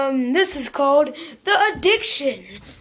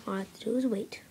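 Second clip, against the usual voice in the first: resigned whisper; 5 semitones lower, 13 dB quieter, much faster than usual. Acted. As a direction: hush it to yourself. Aside while waiting.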